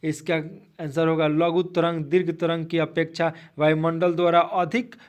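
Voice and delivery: ordinary talk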